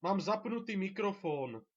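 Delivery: loud, high-pitched shout; exasperated shout. Real, not acted. Player telling someone the microphone is on, shouting. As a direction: shout it across, pitch held high then falling.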